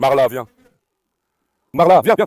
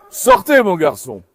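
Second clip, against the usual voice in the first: shouted order; 5 dB louder, slower than usual. Real, not acted. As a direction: shout it as a command. Ordering a dog out.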